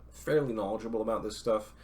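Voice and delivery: ordinary delivery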